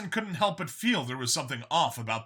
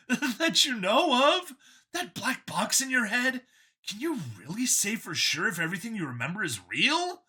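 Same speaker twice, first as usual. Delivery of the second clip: a cheerful zealot pitched up; android copy, eager; 9 semitones higher than usual. A narrator's reading. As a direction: sell it like a proud believer. Copy taunts Vincent.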